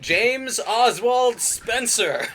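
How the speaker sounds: loud, high, slow scold; full-name scolding. Pretend. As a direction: bellow the full name like a parent.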